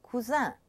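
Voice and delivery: "cousin" in French, ending in a nasal vowel; no N sounded at the end of "cousin"; masculine ending. Shown as a demonstration. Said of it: "this sound un is spelled sometimes I N".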